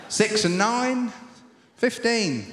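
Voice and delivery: bingo caller. Acted. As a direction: draw it out like a caller. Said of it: "update the bingo calling".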